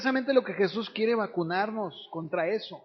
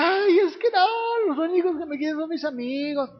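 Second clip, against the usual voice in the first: put-on teen whining; 7 semitones higher, 4 dB louder than usual. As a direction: wail it like a self-pitying teenager. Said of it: "y yo decía".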